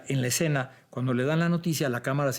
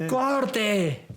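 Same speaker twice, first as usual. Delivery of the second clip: calling cut; 8 semitones higher than usual. Retold or imitated. Director calling cut on set.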